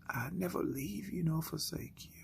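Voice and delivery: soft whisper, no voice; still small voice. Acted. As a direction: whisper the promise softly. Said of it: "you continue to hear that voice".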